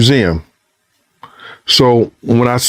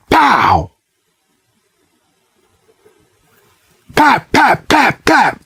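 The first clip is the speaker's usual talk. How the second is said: high popping mouth gunshots; imitated gunshots; 10 semitones higher, much slower than usual. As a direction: pop out the shots like a kid playing guns.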